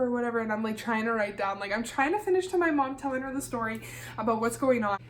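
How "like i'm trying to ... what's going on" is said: ordinary delivery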